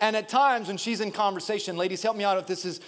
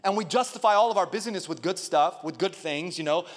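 normal talking voice

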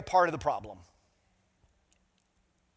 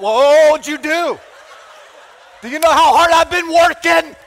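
loud, much higher hammed-up yell; mock angry shout; 10 semitones higher, 14 dB louder than usual. Pastor replays shouting at his wife about hard work, hammed-up loud yell. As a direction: shout back at your wife with a laugh. Retold marital argument, shouting.

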